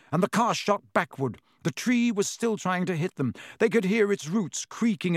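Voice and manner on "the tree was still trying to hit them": plain reading voice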